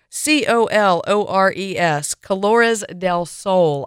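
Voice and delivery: slow, careful letter-by-letter spelling; spelled out carefully. Shown as a demonstration. Host spells the wine name for listeners, slow and careful. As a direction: enunciate each letter slowly. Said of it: "I will try and enunciate carefully".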